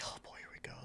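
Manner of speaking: under-the-breath whisper, resigned; whispered aside. Retold or imitated. Man whispering to himself at the table, resigned. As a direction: whisper it to yourself like here we go again. Muttered aside while retelling encounter.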